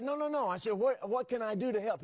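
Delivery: high-pitched pleading retort; urgent protest. Retold or imitated. Father refusing to hang up on his son, urgent protest. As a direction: jump in high, insisting on helping.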